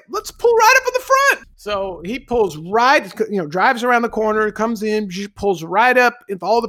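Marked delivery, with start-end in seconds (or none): 0.1-1.5 very high-pitched hollered request; excited shout. Retold or imitated. Passenger urging the driver to the hotel front, excited shout. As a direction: yell it gleefully at the top of your range.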